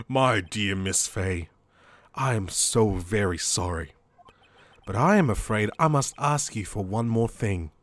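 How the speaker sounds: mock-apologize as a menacing villain; deep, measured bad guy. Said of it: "I wanna do a bad guy voice".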